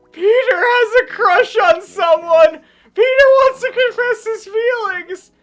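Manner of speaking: high-pitched excited yell; excited shouting. Real, not acted. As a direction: shriek it out with glee. Excited gaming reaction, shouting.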